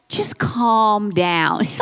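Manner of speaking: slow, patronizing calm down; drawn-out calm down. Retold or imitated. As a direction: stretch it out like a know-it-all friend. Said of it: "someone's like".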